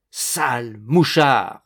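loud, slow insult; angry cry. A narrator's reading. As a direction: hurl the insult word by word. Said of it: "cria-t-il".

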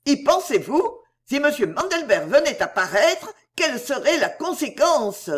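high, loud angry cry; angry outburst. Acted. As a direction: scold in outrage. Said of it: "d'un ton de colère".

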